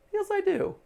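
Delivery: very high, smiley answer. Acted. Answering a neighbour's suspicion, high-pitched and smiley. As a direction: squeak it out through a big smile. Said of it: "this is a good smile opportunity".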